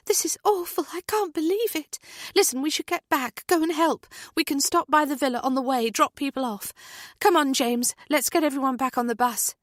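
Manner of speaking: high, brisk and urgent friend voice; shocked friend. A narrator's reading. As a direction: take charge, get everyone moving. Natalie urges group back.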